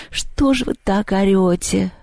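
slow, drowsy complaint; groggy, drawn-out grumble; sleepy. Acted. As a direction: ask it half asleep, dragging the words. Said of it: "сонно спросила Люба".

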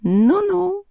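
high, drawn-out cat murmur; sceptical cat. A narrator's reading. The cat reacts to Masha's answer, sceptical cat. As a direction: hum it sceptically.

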